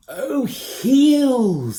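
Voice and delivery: high, loud dawning realisation; realising. A narrator's reading. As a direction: finally get it, relieved. Ben understands at last.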